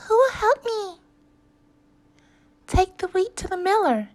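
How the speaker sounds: high, loud, fast hen plea. Acted. The hen asking for help with the wheat. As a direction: ask like a busy little hen.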